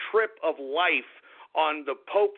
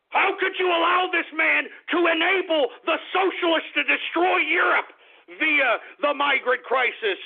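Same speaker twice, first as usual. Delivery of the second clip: high fire-and-brimstone prophet shout; mock biblical prophet; 9 semitones higher than usual. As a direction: rail to heaven like a prophet.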